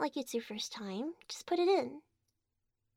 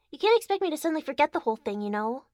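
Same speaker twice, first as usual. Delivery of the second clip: rushed complaint; 3 semitones higher, 8 dB louder, faster than usual. Acted. Heated complaint to partner.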